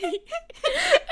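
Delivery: squealing high laugh. Real, not acted. Laughing at her mother's stunt, high squealing laugh. Laughing while telling story.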